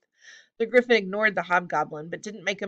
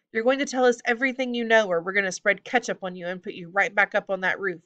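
ordinary delivery, nothing marked

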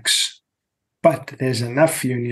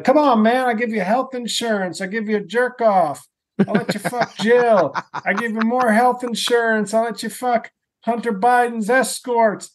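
much higher, wheedling mock Biden voice; Sleepy Joe impression; 10 semitones higher than usual. Mock Biden pitching union guys, much higher and wheedling. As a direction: pitch way up and promise everything, come on man. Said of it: "Sleepy Joe is like".

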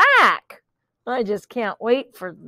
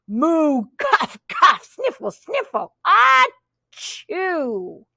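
higher moo, coughs and sniffles; sick mooing pig; 4 semitones higher, much slower than usual. Acted. Reading to a child, the pig moos and sneezes. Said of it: "said the pig".

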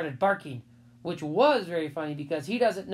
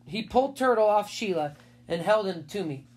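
plain reading voice

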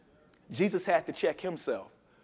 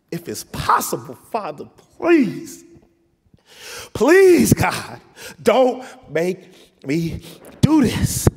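desperate cry to God; 8 dB louder, slower than usual. Acted. Jesus begging the Father to spare him, anguished cry. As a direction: cry out please with all you have. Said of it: "he's like".

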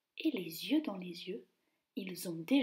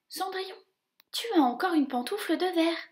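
high, fast squeaky mouse voice; a little mouse bursting with news; excited mouse; 8 semitones higher, 6 dB louder, faster than usual. Acted. Mouse telling Cinderella about the slipper, excited squeak. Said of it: "un souriceau s'exclame".

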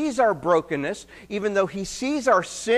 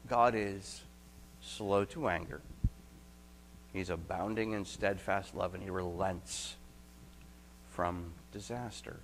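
tender; 10 semitones lower, 14 dB quieter than usual. Real, not acted. Preacher softens describing God's love.

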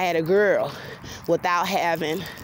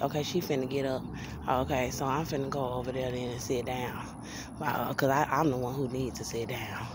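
low, soft aside to self; quiet aside; 5 semitones lower, 9 dB quieter than usual. Real, not acted. Woman eyeing the occupied bench, quiet aside. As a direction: drop the voice well down, as if not to be overheard. Aside about lady sitting nearby.

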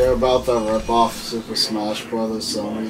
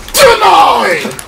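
high, loud gloating yell; triumphant shout; 10 dB louder than usual. Real, not acted. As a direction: shout it in his face. Rejecting opponent's plea triumphantly.

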